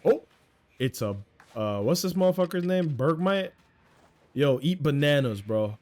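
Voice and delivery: usual speaking voice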